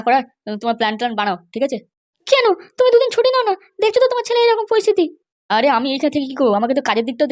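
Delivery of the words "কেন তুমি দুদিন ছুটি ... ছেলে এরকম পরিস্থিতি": much higher-pitched woman's voice; a high female character voice; mother's voice. Acted. Wife complains to husband.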